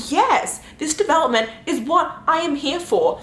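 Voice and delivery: retold delight. Reader reacting to a revenge queen, delighted.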